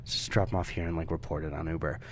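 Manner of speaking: low, very soft murmur to a friend; quiet aside. Retold or imitated. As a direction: say it under your breath, confidentially. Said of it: "kinda quietly go like".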